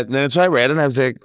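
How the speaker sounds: normal talking voice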